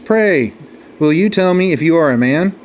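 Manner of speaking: polite, deferential lion, lightly voiced; a humble lion asking politely; very respectful. A narrator's reading. Lion politely greeting the camel, very respectful. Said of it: "said very respectfully".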